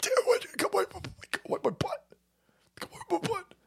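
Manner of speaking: tiny high, hushed voice; mock pleading. Mocking Biden begging to be wiped, high hushed pleading. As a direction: squeak it softly, half whispered.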